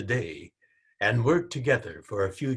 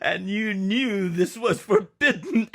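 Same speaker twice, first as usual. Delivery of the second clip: louder, higher amused tease breaking into a laugh; 10 semitones higher, 6 dB louder than usual. Acted. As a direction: tease your wife, then laugh out loud. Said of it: "he smiled".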